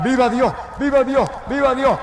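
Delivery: high, repeated cries of praise; urgent high-pitched chant-like cries; retold shouting. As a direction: act out an uncontrollable outburst of shouting. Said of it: "de pronto empecé a gritar".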